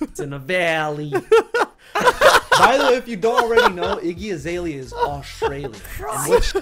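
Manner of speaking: high squealing laughter through the words; can barely talk for laughing; laughing hard. Real, not acted. Streamer cracking up explaining [name] is Australian, high laughing.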